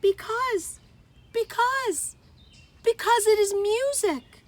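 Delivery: high, loud stammered because; pleading insistence. A narrator's reading. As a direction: struggle for words, then insist. Geraldine defends the statue.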